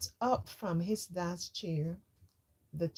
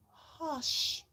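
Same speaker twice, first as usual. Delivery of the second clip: higher, sharp hush from dad; dad's hush; 11 semitones higher, 3 dB quieter than usual. A narrator's reading. Father hushing the noisy bath upstairs. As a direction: shush the house from downstairs. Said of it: "came Dad's voice up the stairs".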